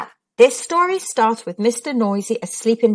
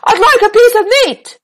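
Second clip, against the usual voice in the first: loud, higher booming voice; 11 semitones higher, 6 dB louder than usual. Acted. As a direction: boom it across the shop. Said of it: "he boomed".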